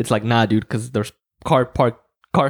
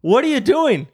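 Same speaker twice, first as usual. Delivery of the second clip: higher, exasperated rant; exasperated outburst; 8 semitones higher than usual. Real, not acted. Ranting at slow fast-lane driver.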